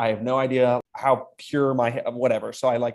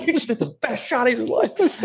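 much higher mock excited cry; imitating excited praise; 12 semitones higher than usual. Golfer shaking him after the tee shot, much higher. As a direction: echo the golfer's delight, pitched way up.

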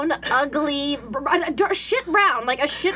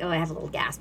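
mild murmur; 9 semitones lower, 8 dB quieter, faster than usual. Retold or imitated. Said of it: "I'm like".

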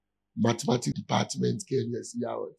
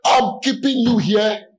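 much higher and louder, slower on the middle line; excited loud call; 9 semitones higher, 12 dB louder, slower than usual. Real, not acted. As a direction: burst out to the room, still amused. Jokes with the congregation after laughing.

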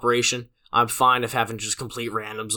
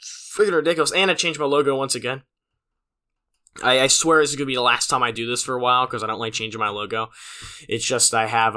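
ordinary talk, nothing marked

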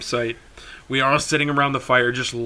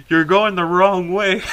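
moderately raised, higher mock holler; retold yell; 5 semitones higher, 4 dB louder than usual. Recapping the uncle's warning for a friend, mild mock yell. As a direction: lighter imitation of a warning shout. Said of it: "my uncle screaming behind me".

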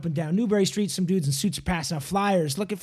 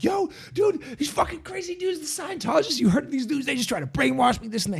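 high-pitched, panicky rant to a friend; re-enacted paranoid babble, pitched way up; frantic retelling; 8 semitones higher than usual. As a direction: act out freaking out on the phone. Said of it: "you sound like you're on shrooms".

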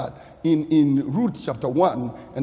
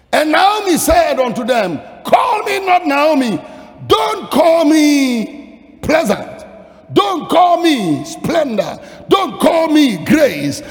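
high, shouted sermon with hammered repetition; impassioned preaching; 10 semitones higher, 9 dB louder than usual. Acted. Preacher voicing Naomi's bitter reply to the townspeople, impassioned preaching. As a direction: cry out each refusal at full force.

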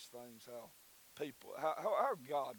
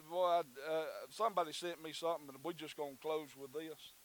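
ordinary talk, nothing marked

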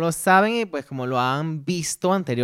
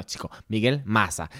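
"Maza" with a plain s sound, Venezuelan style; Venezuelan pronunciation; 3 semitones lower than usual. Shown as a demonstration. Host corrects himself, Venezuelan pronunciation. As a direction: say "Maza" as "Masa", no lisp. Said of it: "como lo decimos en Venezuela".

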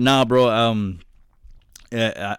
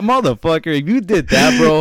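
loud, higher mock outburst; mock outrage; 6 semitones higher, 6 dB louder than usual. Reacting to hearing cancer news on the podcast, loud mock outrage. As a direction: yell it at a friend in fake fury. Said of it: "I'm like".

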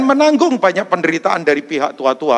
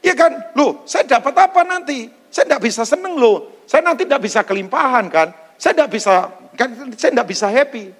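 selfish disciple; 6 semitones higher than usual. Acted. Peter's selfish thoughts voiced.

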